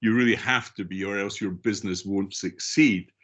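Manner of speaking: usual speaking voice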